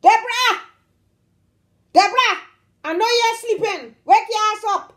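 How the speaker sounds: high, loud yell to wake the house; calling out. Acted. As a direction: holler up at a window for her. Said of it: "She will come and say".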